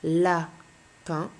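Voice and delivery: "lapin" in two beats, la, pause, pin; split into syllables. Shown as a demonstration. Teacher sums up the rule for pupil, "lapin" split la, pause, pin. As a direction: break "lapin" after la. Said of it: "qu'il est en deux syllabes".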